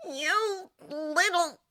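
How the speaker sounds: high, drawn-out exasperated growing rage; strangling exasperation. Acted. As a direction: stretch it out as if throttling someone. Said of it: "strangling Lucifer's neck".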